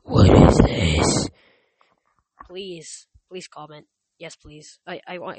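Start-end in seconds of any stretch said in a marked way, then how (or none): 0.0-1.3 mock outrage. Real, not acted. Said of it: "mock outrage at no comments".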